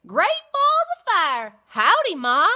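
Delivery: put-on high, loud southern baby goose. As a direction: holler howdy like a little cowboy goose.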